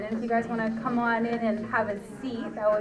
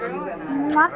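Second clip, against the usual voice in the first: loud smacking kiss sound; 2 semitones higher, 6 dB louder than usual. Real, not acted. Give Mommy a kiss.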